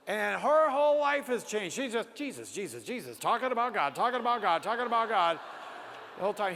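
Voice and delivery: high, loud, gushing repetition of Jesus; carried away, raising pitch and volume on each phrase; a guard describing his transformed wife, gushing; excited retelling. Retold or imitated. Guard retells wife's fervor.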